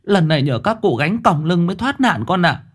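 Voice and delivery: a mother clutching her chest in fright; alarmed mother. A narrator's reading. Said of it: "ôm ngực thở dốc". (giọng bà mẹ hốt hoảng, cao hơn)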